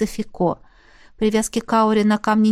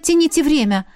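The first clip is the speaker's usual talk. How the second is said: higher, sarcastic repeat of the order; exasperated echo; 6 semitones higher than usual. A narrator's reading. The heroine mocks Alien's order in her head, exasperated. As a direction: throw the order back in disbelief.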